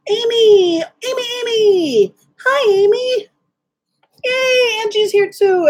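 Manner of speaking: high squealing hellos to friends; thrilled high-pitched welcome to arriving viewers; excited greeting. Real, not acted. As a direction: squeal with delight as friends show up. Friends joining her livestream.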